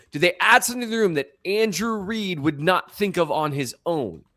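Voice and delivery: ordinary talk, nothing marked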